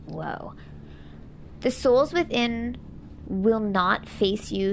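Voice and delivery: ordinary talk, nothing marked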